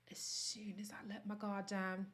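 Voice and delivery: soft, confiding whisper; whispered first take of the line. Shown as a demonstration. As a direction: drop to a whisper for the vulnerable part. Whispered, then repeated aloud.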